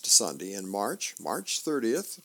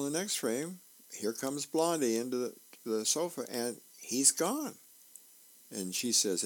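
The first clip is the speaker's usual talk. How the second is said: ordinary talk, nothing marked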